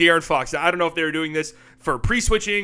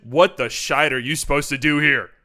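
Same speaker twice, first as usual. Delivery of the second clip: ordinary talk, nothing marked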